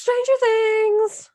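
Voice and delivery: host gushing over a favorite show; eager answer. Real, not acted. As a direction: savor the favorite title. Host answers own question eagerly.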